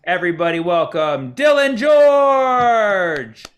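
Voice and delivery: rousing emcee intro, stretching the final name; show announcer introduction. Real, not acted. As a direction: announce the act, ending in a big shout. Host introducing the magician on stage.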